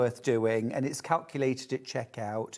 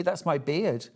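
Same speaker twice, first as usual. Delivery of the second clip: ordinary talk, nothing marked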